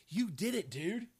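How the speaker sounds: loud, slow praise; encouraging Jesus. Retold or imitated. Jesus praising Peter for walking on water, loudly. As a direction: cheer Peter on. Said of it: "it's almost like he says".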